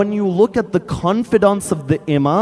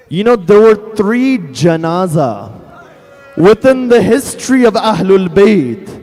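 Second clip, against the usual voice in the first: normal talking voice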